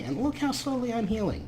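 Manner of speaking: ordinary talk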